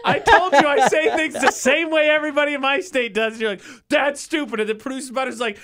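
laughing protest. Real, not acted. Laughing defending her state's talk.

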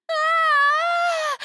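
high, loud shriek of fright; piercing frightened "Ah!"; scream. A narrator's reading. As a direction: scream out in sudden terror. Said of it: "Her voice broke out in a scream".

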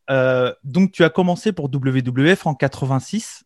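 ordinary talk, nothing marked

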